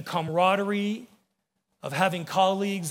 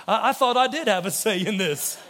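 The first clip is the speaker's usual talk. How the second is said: laughing realization; 2 semitones higher, 4 dB louder than usual. Retold or imitated. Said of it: "and you go".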